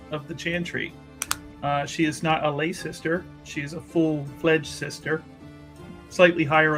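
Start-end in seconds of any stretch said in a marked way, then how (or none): none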